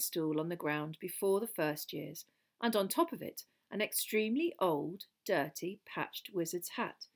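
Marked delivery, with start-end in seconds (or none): none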